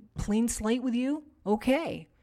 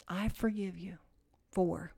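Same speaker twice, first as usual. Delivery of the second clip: soft repeated forgiveness; 4 semitones lower, 8 dB quieter than usual. Shown as a demonstration. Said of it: "seven times".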